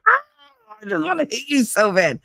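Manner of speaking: high-pitched mock outburst of frustration. Retelling her angry crying as a teen, mock frustrated squeal. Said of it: "just pure tears of frustration".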